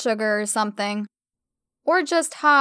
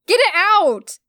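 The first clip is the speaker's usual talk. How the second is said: high, loud frantic screech; panicked screech; 8 semitones higher, 6 dB louder, slower than usual. A narrator's reading. Emma shrieks, frantically clawing at her hair. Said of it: "I screeched".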